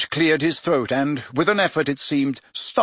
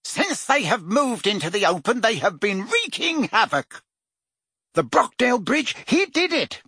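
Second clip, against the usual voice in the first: higher, agitated minister; Fudge's voice, agitated; 7 semitones higher than usual. A narrator's reading. Fudge tells the Prime Minister of the attacks, higher and agitated. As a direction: climb in pitch as the news worsens.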